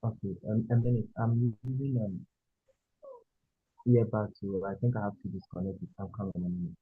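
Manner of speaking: very faint, distant voice; speaking too far from the mic; barely audible. Real, not acted. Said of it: "the voice is very faint".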